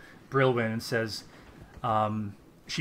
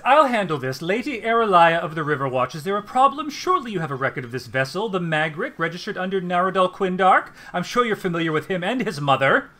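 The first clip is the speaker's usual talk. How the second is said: higher, louder, commanding noblewoman; noble lady; 5 semitones higher, 5 dB louder than usual. Acted. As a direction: step in and take charge with authority. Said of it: "she says".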